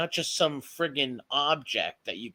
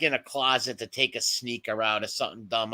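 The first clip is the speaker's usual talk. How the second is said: ordinary talk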